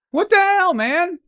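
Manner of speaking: jokingly indignant, pitch jumps way up; outraged disbelief. Real, not acted. Friend just called him idiot.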